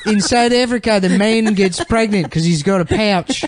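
bad put-on South African accent; mock South African accent. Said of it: "our version of the South African accent".

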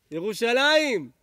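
loud, slow call to the crowd; rally the room with one word. Real, not acted. Calls out to the crowd.

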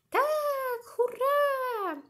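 very high, loud drawn-out hurray; squealed whoop of delight; excited child's cheer. Acted. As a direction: cheer like an overjoyed little boy. Child cheers at going home.

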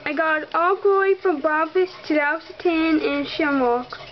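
ordinary talk

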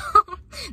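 short high burst of laughter; quick high laugh. Real, not acted. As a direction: laugh at yourself. Laughs at her own claim.

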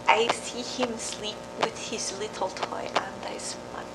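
soft, unvoiced whisper; tender whisper. Mother describing her sleeping boy in a whisper. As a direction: whisper as if not to wake him.

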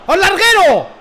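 high, loud shout at the crossbar. Real, not acted. Gamer yelling as his shot hits the crossbar. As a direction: yell it out as the ball hits the bar.

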